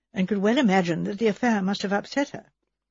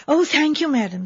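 higher, relieved thanks; a maid thanking her mistress warmly; grateful maid; 6 semitones higher, 3 dB louder than usual. Acted. Maid Edna thanking Miss Marple, higher and relieved.

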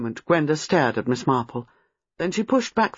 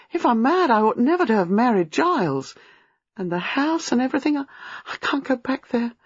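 put-on high, wailing young woman; high-pitched helpless distress; distraught; 8 semitones higher than usual. A narrator's reading. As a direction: spill it out in rising panic. Distraught woman pours out her fears.

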